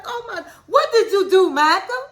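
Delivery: a laughing, high mock of a fussing wife; mocking Angela. Wife mocking Michael's blunder, imitated high and laughing. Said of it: "And she was like".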